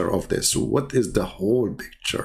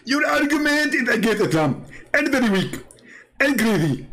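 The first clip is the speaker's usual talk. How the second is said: mocking commenter voice; 10 semitones higher than usual. Reading the commenter Mister Submission's reply aloud, mocking and high. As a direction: sneer his comment back in a higher voice. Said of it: "This is Mister Submission".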